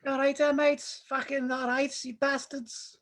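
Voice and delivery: a light put-on Scouse accent. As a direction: greet them like a Liverpool lad. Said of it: "That's my best one".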